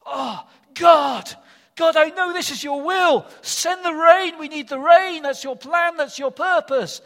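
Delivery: high, loud, pleading cry; passionate loud prayer. Acted. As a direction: call out to God, desperate. Elijah praying again, passionately.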